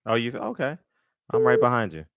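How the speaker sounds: usual speaking voice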